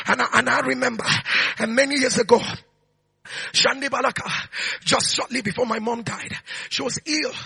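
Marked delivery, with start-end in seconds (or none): none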